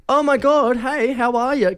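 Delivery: put-on high, excited surprise at an old friend; excited greeting. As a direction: squeal out a delighted hello. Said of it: "and goes".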